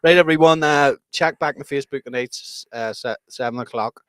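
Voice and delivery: outro announcement. Real, not acted. Podcast sign-off.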